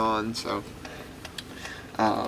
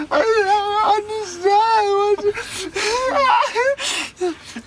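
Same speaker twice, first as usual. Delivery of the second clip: long, high, loud mock bawl; comic blubbering of a distraught boy; crying; 6 dB louder, much slower than usual. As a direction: drag the words out in a hammed-up wail. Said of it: "crying, tears pouring down his face".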